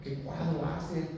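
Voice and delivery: soft, slow wow of admiration; hushed awe. Acted. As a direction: marvel quietly, drawing the words out. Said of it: "y usted dice, "Wow".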